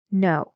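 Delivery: "No" dropping down; a short "No" that falls to a close; falling intonation. Shown as a demonstration. Teacher reads an example to sort, falling "No". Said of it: "It has a falling intonation".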